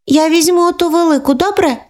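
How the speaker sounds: put-on high, asking child voice; hopeful little girl. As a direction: ask for the big doll. Sister asks for the big doll.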